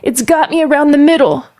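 put-on high, urgent child's report; alarmed child. Girl in the river tells mama she is caught, alarmed. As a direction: call out in mild panic from the water. Child held by kraken calls out.

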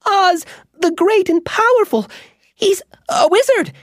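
high squeaky mouse voice, nervous boast; squeaky mouse bragging. Acted. Mouse Bobbin boasting of his protector to the witch, squeaky and nervous. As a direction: puff up a tiny voice to sound protected. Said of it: "he added".

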